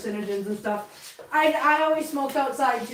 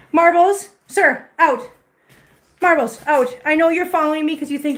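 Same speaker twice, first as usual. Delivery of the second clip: loud firm call to the cat, louder; 3 semitones higher, 8 dB louder than usual. Real, not acted. Owner sends the cat Marbles out of the room, firm and loud. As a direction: order the cat out firmly.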